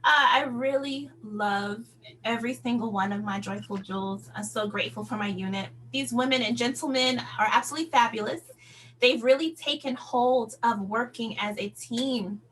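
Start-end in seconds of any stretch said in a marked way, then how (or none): none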